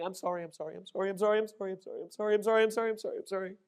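put-on a kid blurting sorry over and over in a rush; panicked screaming.

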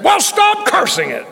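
a hammed-up booming heavenly rebuke; voice of the Lord. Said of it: "I hear that voice".

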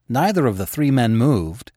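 ordinary delivery, nothing marked

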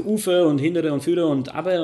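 „Ufe“, „Hindere“, „Führe“, „Abbe“ auf Schweizerdeutsch gesagt; Schweizerdeutsch nachgemacht. Retold or imitated. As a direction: Kommandos der Frau im Dialekt nachgeahmt. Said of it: "da hat sie halt gesagt". (Schweizer Mundart, leicht imitiert)

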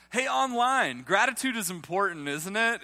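high, loud call to the viewers. Real, not acted. Pastor calling out to online viewers, high and loud. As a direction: wake up the online crowd.

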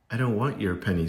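usual speaking voice

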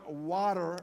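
WATER with an American r; best American accent. Shown as a demonstration. Foreigner summing up his best attempt, American accent. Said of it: "is my best American that I can do".

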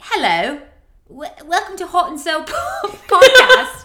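mock British accent, rising into laughter; a playful put-on British host greeting, breaking up laughing; hammed-up British accent that cracks into a laugh. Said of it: "I could do British".

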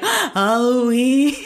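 high, long-drawn mock wail; flipped out. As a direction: wail the answer slowly, imitating your panicked self. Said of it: "I was so, like, fucking flipped out".

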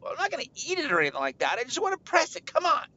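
put-on high-pitched kid insisting; impatient kid pleading. Kid wants to press it, high impatient pleading. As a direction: argue back quickly, climbing high.

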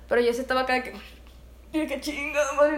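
ordinary talk

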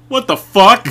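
high, loud startled yell; shocked outburst. Retold or imitated. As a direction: blurt it out in disbelief. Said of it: "you'd just be like".

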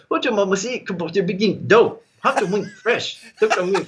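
hammed-up Yankee rapper imitation; mock hip hop slang. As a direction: lampoon a hip hop translation with a laugh in it. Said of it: "So it'd be like".

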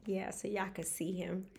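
whispered aside with a giggle. Real, not acted. Host whispers to viewers about her brother, then giggles. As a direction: breathe it out as a secret.

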